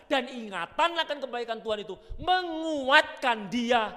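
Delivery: high, loud, ringing sermon voice; full-force preaching. Acted. Preacher drives the point home to the congregation, ringing. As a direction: preach it out to the hall.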